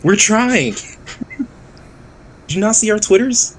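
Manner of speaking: high-pitched pleading comeback; mock defensiveness. Acted defensive reply to the screamers.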